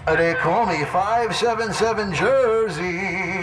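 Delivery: high slow drawn-out radio handle call. Shown as a demonstration. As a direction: announce your handle proudly over the air. CB handle announcement.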